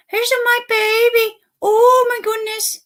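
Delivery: high cooing pet voice, delighted. Real, not acted. Owner showing his dog to viewers, high gushing coo. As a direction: squeal it over a puppy in your arms.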